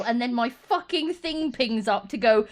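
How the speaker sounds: ordinary talk, nothing marked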